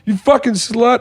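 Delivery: high, drawn-out mock insults; hammed-up high parting rant; imitated abuse. Storyteller imitates Hammer leaving the shop, high mock abuse. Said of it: "Hammer came out and said".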